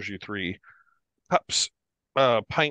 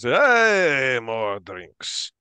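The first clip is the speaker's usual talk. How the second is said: rowdy cheer; 5 dB louder, much slower than usual. Acted. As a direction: cheer like drinkers raising their glasses. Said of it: "they sort of cheers".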